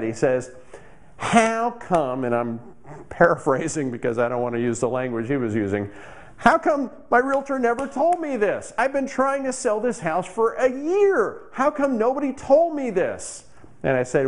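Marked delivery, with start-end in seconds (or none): 1.2-2.2 frustrated. Retold or imitated. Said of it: "huffing and puffing and get frustrated". 6.4-13.5 high, frustrated complaint, slower at the end; frustrated outburst. Retold or imitated. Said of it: "huffing and puffing and get frustrated".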